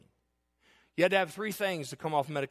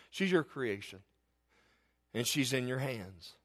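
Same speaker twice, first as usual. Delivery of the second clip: tearful prayer; 4 semitones lower, 5 dB quieter than usual. Retold or imitated. Retold prayer while crying.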